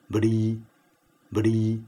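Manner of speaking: 不离 as bṛ, voiced b without a puff; B with dotted R. Shown as a demonstration. Teacher drills B R一点: 不离 as bṛ. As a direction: say 不离 as "bṛ", light r. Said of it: "B R一点".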